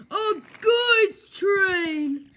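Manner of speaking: slow wailing lament; melodramatic. Acted. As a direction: wail it like a tragedy. Repeated with rising wail.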